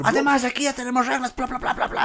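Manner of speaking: high-pitched mock scolding, voice held back; mimicked yelling. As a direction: parody a teacher's rant, high and rattling. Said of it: "Me ha gritado así". (regaño gritón imitado, agudo)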